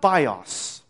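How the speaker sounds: "bios" drawn out very slowly; slow, deliberate "bios", set apart from the sentence; Greek word. Shown as a demonstration. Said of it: "which is the Greek word for life".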